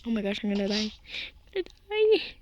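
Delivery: usual speaking voice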